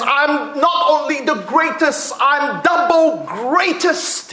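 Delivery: high, slow proud boast; mock boastful scream. Champion boasting to his fans, mock scream. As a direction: brag it to the fans. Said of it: "he would be screamed, to his fans".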